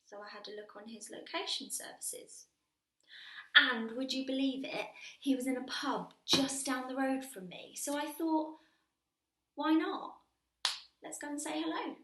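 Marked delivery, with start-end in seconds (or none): 3.6-11.9 loud, high, building delight; gleeful excitement. Acted. Woman telling how she tracked him to the pub, excited. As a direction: share the lucky find with rising excitement.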